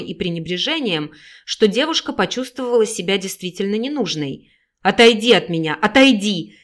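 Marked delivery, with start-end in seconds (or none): 4.8-6.6 loud, furious order; angry yell. Acted. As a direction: bark her away, loud. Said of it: "гневно заорала Екатерина". (гневно заорать)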